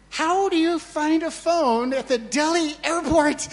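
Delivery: high, loud astonished cry; amazed disbelief. Retold or imitated. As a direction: blurt it out in happy disbelief. Astonished at finding his phone.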